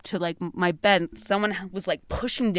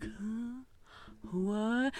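much softer, half-asleep mumble; groggy sister; 2 semitones higher, 14 dB quieter than usual. Retold or imitated. Sister waking up confused after the screaming. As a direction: sound like someone just woken. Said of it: "She's like".

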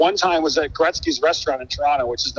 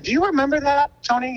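much higher, eager question to Tony; bright high question; 12 semitones higher than usual. Retold or imitated. Asking Tony Hawk on podcast.

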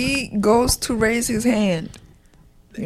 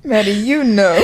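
laughing; 4 dB louder than usual. Real, not acted. Laughing while speaking to Maddie.

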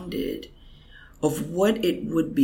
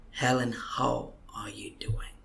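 whisper; 6 dB quieter than usual. Retold or imitated. Asking Helen gently in presence.

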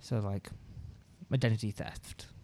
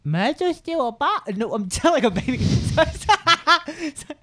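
high, loud, giggling through the words; can't hold the laugh in; laughing burst; 13 semitones higher, 11 dB louder than usual. Real, not acted. Player cracking up at the baby joke, laughing. Laughing outburst mid-game.

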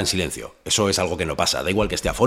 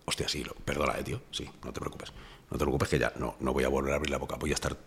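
much softer, murmured mock promise of silence; hushed apology; 8 semitones lower, 12 dB quieter than usual. Murmuring a mock apology to his sleepy bandmate. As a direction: hush right down, as if already vanishing.

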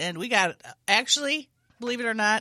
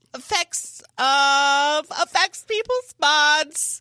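put-on voice; 7 semitones higher, 7 dB louder, much slower than usual. Dead uncle story, put-on voice.

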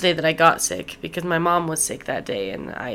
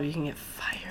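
whispered warning; 5 semitones lower, 14 dB quieter than usual. Real, not acted. Warning about shit-talking your job.

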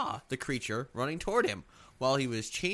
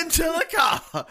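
high, loud preacher shout; screaming preacher; 12 semitones higher, 6 dB louder than usual. Pretend. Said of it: "you're fucking screaming".

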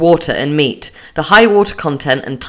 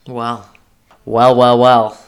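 teasing well well well; 3 semitones lower, 5 dB louder, slower than usual. Acted. Teasing lead-in to dilemma.

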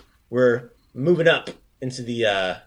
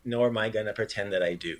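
ordinary talk, nothing marked

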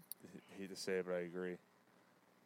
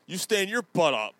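louder, higher mock tough-guy dare; mock senator challenge; 6 semitones higher, 4 dB louder than usual. Podcast host mocks a senator's challenge, mock tough-guy jeer. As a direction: jeer it like a senator picking a fight.

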